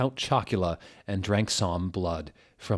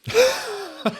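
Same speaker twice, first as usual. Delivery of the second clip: real laugh shooting up high; high burst of laughter; 6 dB louder than usual. Real, not acted. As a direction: crack up helplessly. Reader cracks up at Voldemort.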